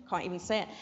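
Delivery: low, laughing aside after a stumble; laughing at self. Real, not acted. Teacher tripping over Italian dictation, laughing at herself. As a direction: chuckle through the admission.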